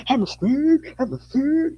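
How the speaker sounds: mock yapping plea; mock dog-like yelping. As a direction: parody of people barking for amnesty. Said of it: "scream and bark like dogs".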